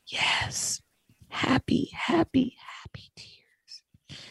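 a hushed, choked-up mother; barely voiced, mother answering through tears; whispered tearful reply. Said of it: "And she said".

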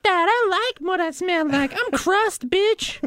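put-on high, nasal-free sneering punk kid; crust punk voice. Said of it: "And you're like".

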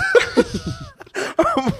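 real high-pitched burst of laughter. Real, not acted. Laughing at a mate's drunk murder text, high-pitched. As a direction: crack up, squealing.

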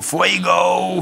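mild mock yell of "Fuego!"; retold shout. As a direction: call out "Fuego!" as a half-hearted alarm. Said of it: "keihard begon te schreeuwen". (trainer roept bij het buffet, half nagespeelde schreeuw)

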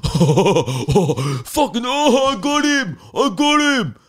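high, loud hooting mock yell; rowdy ape-like dudes. Mimicking private school dudes sack tapping, rowdy ape-like hollering. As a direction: whoop it up like zoo monkeys. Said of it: "like watching monkeys at the zoo".

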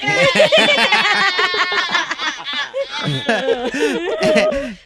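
high hysterical laughter; long shrieking laugh. Real, not acted. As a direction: burst out laughing loudly. Prank collapses into laughter.

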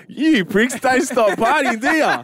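high, hammed-up Aussie yell at the crowd; mock DJ shouting at the crowd; broad Aussie bogan. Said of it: "It's like".

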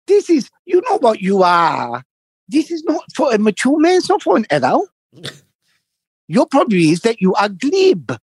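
hammed-up scolding older man; mimicking him. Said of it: "And he said to me".

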